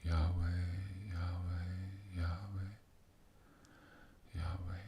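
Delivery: breathy meditative chant of Yahweh; whispered name. Shown as a demonstration. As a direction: whisper Yahweh over and over as you drift off.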